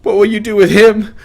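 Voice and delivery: high, loud desperate question; desperate plea. A narrator's reading. Widow asking what happens to her husband, desperate. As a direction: beg to know his fate.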